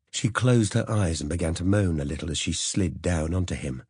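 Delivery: ordinary delivery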